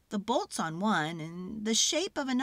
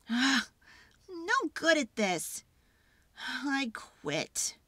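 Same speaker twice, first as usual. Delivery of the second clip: giving up; 4 semitones higher, slower than usual. Acted. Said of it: "and she explodes".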